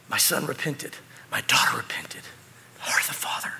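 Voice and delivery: low voice sinking into a whisper; tender father. Acted. Father speaking of his repented children, hushed and tender. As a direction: let it fade, moved, to a hushed whisper.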